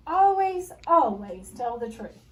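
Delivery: loud, slow, firm insistence; old lady stressing always; emphatic advice. A narrator's reading. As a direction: press the lesson home.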